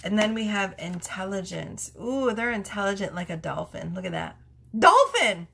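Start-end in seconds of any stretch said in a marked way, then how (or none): 4.8-5.5 high, loud outburst; sudden shrieky excitement; excited exclamation. Real, not acted. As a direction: blurt it out in a high shout. Sudden delighted exclamation at the card.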